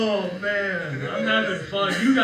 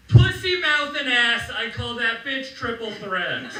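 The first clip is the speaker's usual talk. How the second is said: high mock scream, loudest at the start; screaming mom; 7 semitones higher, 6 dB louder than usual. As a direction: yell it out the car window as mom, easing slightly. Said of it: "your mom's just screaming out the window".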